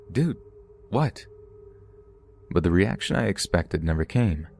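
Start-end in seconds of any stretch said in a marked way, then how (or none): 0.1-1.3 understated yell with a rising "what"; mild yell. A narrator's reading. Narrator yells at his sleepwalking roommate, mild raised-pitch yell. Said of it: "I yelled at him".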